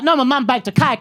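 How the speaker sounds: lightly voiced child arguing back; insistent child. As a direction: pipe up like an insistent kid. Arguing kids in retold story.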